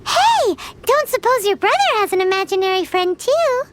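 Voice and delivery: ordinary delivery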